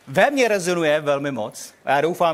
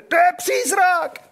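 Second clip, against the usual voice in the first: high, slow, frightened cry of ghost; terrified shout; 11 semitones higher, 4 dB louder, slower than usual. Acted. Disciples see a figure on the sea and cry out in fear. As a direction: shriek it out as if seeing a ghost. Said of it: "A strachem vykřikli".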